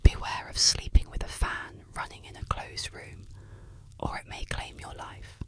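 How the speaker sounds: ordinary delivery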